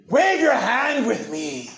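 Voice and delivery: high, slow, drawn-out mock invitation; dramatic false teacher. Preacher mocking false teachers working a crowd, overdramatic. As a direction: stretch it out like a showy preacher.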